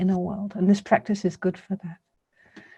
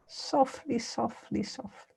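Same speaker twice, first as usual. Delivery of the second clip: each word softer, dying away almost to nothing; softly fading; 4 semitones higher, 8 dB quieter, slower than usual. Real, not acted. Meditation teacher easing listeners into soft awareness, fading softly. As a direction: let the repeated word sink into near silence.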